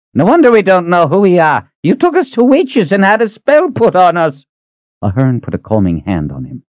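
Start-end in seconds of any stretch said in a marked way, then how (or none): 0.1-4.5 put-on high, outraged old man's voice; alarmed old man. Old Reuben accusing Ahern about the witches, high and outraged. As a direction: panic and blame in one breath.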